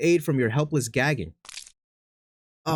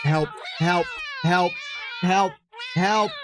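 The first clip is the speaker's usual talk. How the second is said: mock cry for help; 7 semitones higher, much slower than usual. Calling for help.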